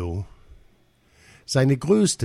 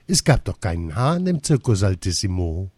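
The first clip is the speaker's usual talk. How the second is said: usual speaking voice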